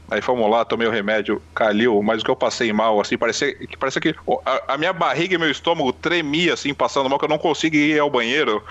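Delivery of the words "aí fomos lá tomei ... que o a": ordinary talk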